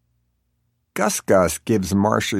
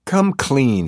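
higher, firm directive; commanding, pitched up; directing; 5 semitones higher, 3 dB louder than usual. A narrator's reading. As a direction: give it as a pointed order. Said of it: "he directs".

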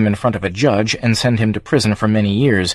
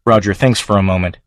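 ordinary delivery, nothing marked

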